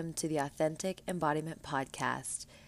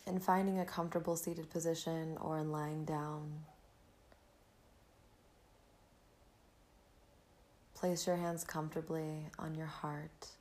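breathy calm, guiding the listener inward; soft spa whisper; 3 dB quieter than usual. Acted. Leads a gratitude meditation.

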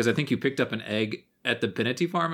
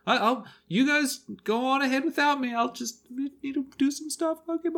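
much higher, hurried barmaid voice; barmaid excuse; 12 semitones higher than usual. Acted. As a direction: chirp it out while slipping away. Barmaid fleeing the story.

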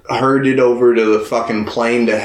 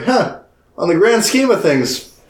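higher, lightly put-on, musing voice; airy appraisal, pitched up throughout; mock nonchalant Goku; 8 semitones higher than usual. Goku judging two fighters beneath him, mock nonchalant.

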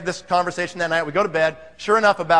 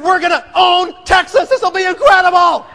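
ecstatic shouting; 9 semitones higher, 8 dB louder than usual. Retold or imitated. Husband promising wife great success, ecstatic shout. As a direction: bellow it, wildly excited.

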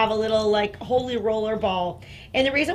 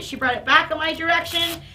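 usual speaking voice

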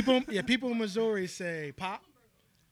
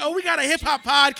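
mock quote of her; 6 semitones higher, 11 dB louder than usual. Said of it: "She's like".

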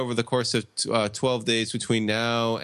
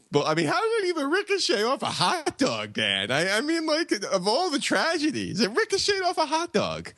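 much higher, disbelieving rant; incredulous. Real, not acted. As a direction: squeak out the absurdity. Incredulous outburst about the ricochet.

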